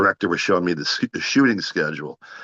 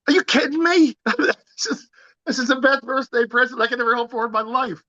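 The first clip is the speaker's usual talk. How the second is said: much higher, squealing with delight; thrilled disbelief. Retold or imitated. Telling the director he would not dream of rescheduling, overjoyed and much higher. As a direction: gush like a kid handed the best present.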